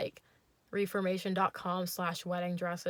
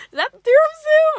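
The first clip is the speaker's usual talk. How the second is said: far higher, louder and fast goofy squeal; yay voice; 19 dB louder, faster than usual. Retold or imitated. Date squeals at the tiramisu in a goofy voice. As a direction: squeal it like an overexcited man. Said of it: "yay voice".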